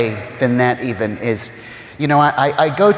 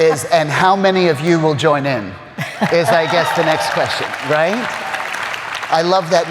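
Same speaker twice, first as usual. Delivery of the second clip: laughing; 5 semitones higher than usual. Real, not acted. Laughing at the challenge.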